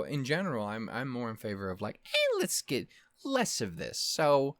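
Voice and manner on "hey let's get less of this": higher, slow put-on voice; slow, high mock-polite request; mock suggestion. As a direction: sweetly propose it, slowly. Acted quote of own suggestion.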